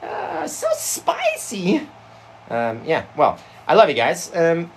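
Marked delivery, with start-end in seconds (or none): 0.0-1.8 high, very slow squeaky coo; streamer parody. Acted. Reprising the livestream girl parody, slow high coo. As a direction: stretch it out in falsetto.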